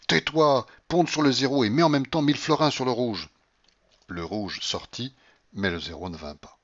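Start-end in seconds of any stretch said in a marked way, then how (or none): 0.1-3.3 louder, higher impatient order; bossy command. A narrator's reading. Grandmother ordering her companion to bet, bossy. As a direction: shut down the objection and order.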